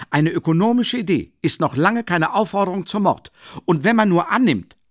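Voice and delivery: high, loud, lofty objection; haughty Luschin. Acted. Luschin belehrt Raskolnikow hochmütig, laut und hoch.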